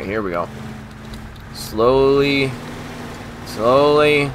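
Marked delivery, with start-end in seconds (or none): 1.5-4.3 slow, held-out vowels, creeping along carefully; drawn-out careful coaxing. Real, not acted. Gamer easing a hard-to-drive truck along, drawn-out and careful. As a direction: stretch each word out long and steady.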